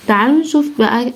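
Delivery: normal talking voice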